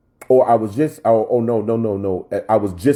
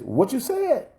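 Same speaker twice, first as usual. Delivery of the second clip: a lightly voiced taunting devil; the enemy sneering at the preacher's values; mocking enemy. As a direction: scoff at each value in a high mock voice. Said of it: "The enemy says".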